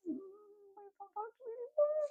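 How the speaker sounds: tiny, very high, soft squeak; mock shutting-out. Stay-at-home mom staring at the sky, mock aloof. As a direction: squeak it faintly as if tuning someone out.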